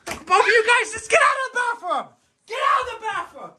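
high, fast yelled order; angry shouting. Real, not acted. Ordering kids out of bathroom.

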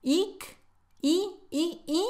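"Ick" as a high eek, then "i" as high ee sounds; mock the mistake with high, tense ee on "Ick, i, i, i"; high-pitched repeated ee for "i"; wrong tense vowel. Shown as a demonstration. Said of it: "es un sonido corto y relajado".

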